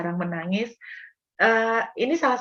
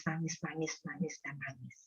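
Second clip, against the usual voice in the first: fading away; 4 semitones lower, 16 dB quieter than usual. Shown as a demonstration. Repeating crying, fading.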